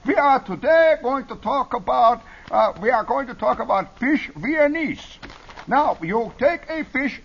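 high, slow mock Viennese chef; phony Viennese accent. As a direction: lecture grandly in a hammed-up Viennese accent. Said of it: "a Viennese chef or something".

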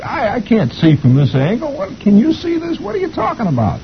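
very high, squeaky protest; complaining husband. A narrator's reading. Husband strains to see from front row, high complaint. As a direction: whine it up high, stammering at first. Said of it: "poor old mister is looking straight up".

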